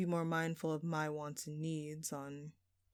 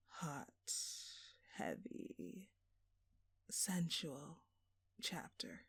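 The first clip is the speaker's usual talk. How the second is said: breathy, much softer whisper, word by word; seductive whisper; 11 dB quieter, slower than usual. Acted. Seductive tease to the detective.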